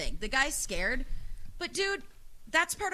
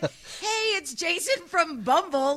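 mock friendly greeting; 6 semitones higher, 6 dB louder, slower than usual. Voicing what he should've said.

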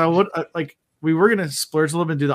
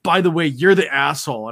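retold yell; 3 semitones higher, 8 dB louder than usual. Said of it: "he just, like, yells over at me".

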